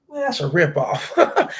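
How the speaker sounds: ordinary talk, nothing marked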